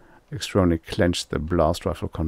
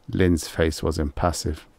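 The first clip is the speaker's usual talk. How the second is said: plain reading voice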